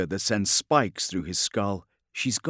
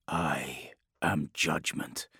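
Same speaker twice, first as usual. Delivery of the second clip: slow, lower whisper; menacing whisper; 5 semitones lower, 7 dB quieter, slower than usual. Acted. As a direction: breathe the words like a threat. Drops to dark whisper.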